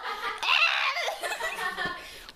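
high shrieking laugh. Real, not acted. Laughing after spray.

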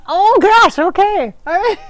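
high, loud giddy fluster; flustered delight. Real, not acted. Reacting to George's hug and kiss, flustered delight. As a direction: squeal it out, overwhelmed and giddy.

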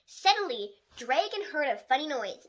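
ordinary delivery, nothing marked

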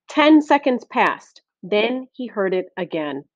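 usual speaking voice

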